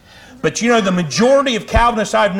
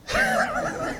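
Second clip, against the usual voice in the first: high laugh; 8 dB quieter than usual. Real, not acted. Laughs at his own joke.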